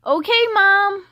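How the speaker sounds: loud, high answer across the room; yelling back. A narrator's reading. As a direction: shout it back to mom. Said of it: "Owen yelled back across the room".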